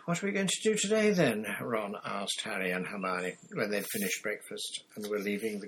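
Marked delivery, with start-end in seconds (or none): 0.0-1.5 put-on high, quick boyish question; eager teen boy. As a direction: rattle it off like a keen boy. Ron asks eagerly.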